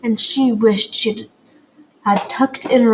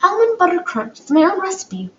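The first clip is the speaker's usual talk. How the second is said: put-on girl voice; 9 semitones higher than usual. A narrator's reading. Poppy proudly offering.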